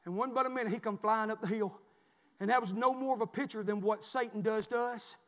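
usual speaking voice

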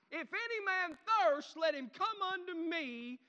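high, loud, slow shout; yelled cry. Acted. Preacher showing how Jesus cried out, loud passionate yell. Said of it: "he got up and yelled".

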